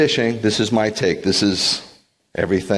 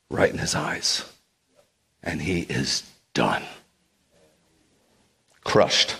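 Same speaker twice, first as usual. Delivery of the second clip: breathy whisper, slow and crushed; 4 semitones lower, 5 dB quieter, slower than usual. A narrator's reading. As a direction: drop to a whisper of grief. Climax of Peter's shame.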